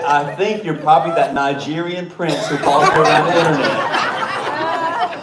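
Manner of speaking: laughing through quote. Retold or imitated. Quoting man, laughing, louder.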